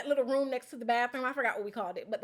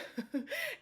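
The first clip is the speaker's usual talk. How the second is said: short giggle; 13 semitones higher, 8 dB quieter than usual. Real, not acted. Giggle before going into game mode.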